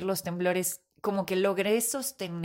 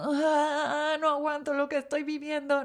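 retold crying cry; 7 semitones higher, slower than usual. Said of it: "estaba llorando, estaba como gritando".

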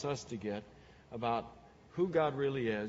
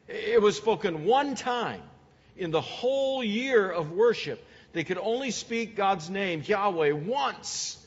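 higher, louder, slowing on "once"; preaching at full force; 5 semitones higher, 5 dB louder, slower than usual. Acted. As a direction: preach it with rising fervor. Preaching builds.

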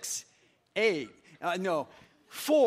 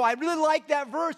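high, loud burst of liking; acted-out keen reader; mock enthusiasm; 9 semitones higher, 7 dB louder than usual. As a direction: gush about it, pitched up.

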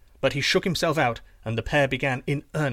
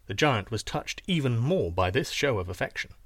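plain reading voice

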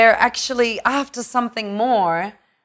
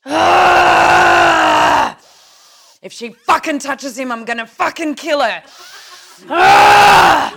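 roaring shouts, much louder; jealous rage; 11 dB louder, slower than usual. Acted. Jealous woman raging about a rival, roaring loud. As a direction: explode with jealous fury. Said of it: "jealous rage acted".

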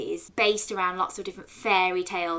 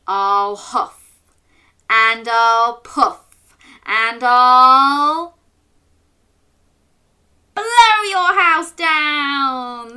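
slow, building louder and higher to a loud blow; big bad wolf; 5 semitones higher, 12 dB louder, much slower than usual. The wolf threatening the little pig, building to a shout. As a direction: huff and puff up to a big shout.

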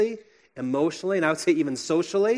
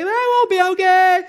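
put-on high, loud wailing child protest; mock child's wail, very high; crying child; 8 dB louder than usual. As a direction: imitate a sobbing toddler refusing. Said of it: "they're just screaming and crying".

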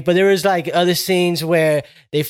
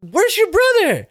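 much higher, put-on casual girl voice; mock sister voice. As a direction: squeak it out like the unbothered sister. Sister voiced in retold movie scene.

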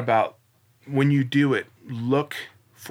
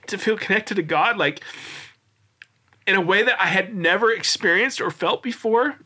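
close to tears; 9 semitones higher than usual. Real, not acted. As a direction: hold back real tears while you tell it. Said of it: "sound like such a baby right now".

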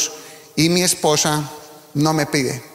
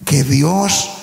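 preaching; 5 semitones higher than usual. Acted. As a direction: preach it with rising fervor. Preaching rises in intensity. (predicando con fervor, voz más alta)

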